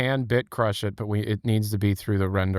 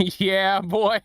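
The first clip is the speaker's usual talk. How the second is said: high silly voice; 9 semitones higher than usual. Pretend. Processed clip played back.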